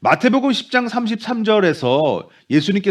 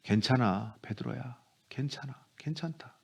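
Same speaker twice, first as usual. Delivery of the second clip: much softer, lower, gently consoling; tender comfort; 6 semitones lower, 15 dB quieter than usual. Pretend. Jesus comforting ashamed Peter, soft and tender. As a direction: soothe a frightened friend softly. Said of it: "연약한 제자 베드로를 긍휼히 여기시는".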